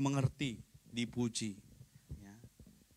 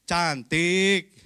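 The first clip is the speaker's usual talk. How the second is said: louder, offhand compliment; insincere answer; 7 dB louder than usual. Shown as a demonstration. Husband answering his wife's question in an insincere, louder tone. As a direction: say it as if you don't mean it. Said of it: "Itu nggak tulus".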